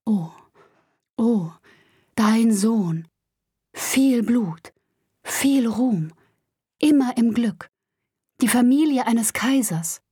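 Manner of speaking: understated ecstatic prophecy in broken fragments; rapt, disjointed stammering. A narrator's reading. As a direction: let each fragment burst out separately, with mild fervor. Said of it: "Er stammelte mit begeisterter Miene folgende Worte ohne Zusammenhang".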